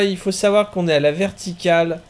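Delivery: ordinary delivery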